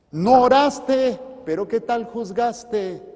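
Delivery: slow, high, loud reproach; drawn-out preacher's scolding; scolding rebuke. Acted. Rebukes the one who judged.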